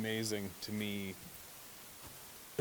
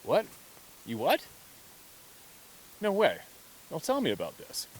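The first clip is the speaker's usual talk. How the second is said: intrigued amazement; 5 semitones higher, 3 dB louder than usual. Acted. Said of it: "brain reacts that way of going".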